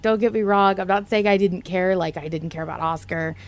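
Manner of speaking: normal talking voice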